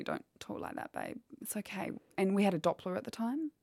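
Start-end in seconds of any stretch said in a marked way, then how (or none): 0.0-1.9 hushed, soft reassurance to a worried partner; soothing partner. Retold or imitated. Partner soothing her fear.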